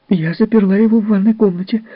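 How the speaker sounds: high, loud, indignant retort; a defiant nurse snapping back; shrill angry nurse. Acted. (высокий возмущённый ответ)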